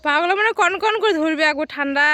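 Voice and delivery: high, loud outdoor call. Real, not acted. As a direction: call across the field. Calling out outdoors.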